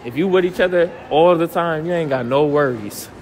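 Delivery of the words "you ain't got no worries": usual speaking voice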